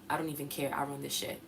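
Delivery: soft, low, calm affirmation; quiet unbothered mantra. Shown as a demonstration. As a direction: say it quietly like it's obvious. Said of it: "Very calmly".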